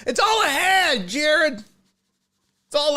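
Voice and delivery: high defensive yell at Jared; defensive shout. Real, not acted. Answering Jared's chat about salted butter, defensive yell.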